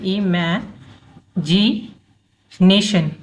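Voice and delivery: "Imagination" broken slowly into pieces, with long gaps between; syllable by syllable. Shown as a demonstration. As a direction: say "imagination" very slowly, pausing after each chunk, i-mae, ji, nation. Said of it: "इसको break करके पढ़ेंगे". ("Imagination" को धीरे-धीरे टुकड़ों में तोड़कर बोलें)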